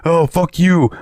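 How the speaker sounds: high, imitated angry rant; retold yelling. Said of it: "a lot of yelling going on".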